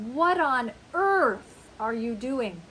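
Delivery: slow, loud, exaggerated incredulity; emphatic disbelief. Shown as a demonstration. Teacher showing a favorite American phrase, slowly incredulous. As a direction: lean long and hard on EARTH. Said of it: "we love to say".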